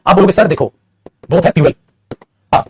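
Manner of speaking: ordinary delivery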